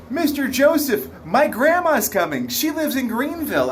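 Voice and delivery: put-on a frightened child tugging your shirt; scared little kid. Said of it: "He was like".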